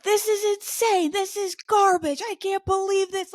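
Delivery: mock tirade, high and strained; retold yelling. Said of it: "I just start yelling".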